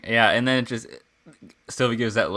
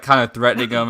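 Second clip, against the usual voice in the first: ordinary talk, nothing marked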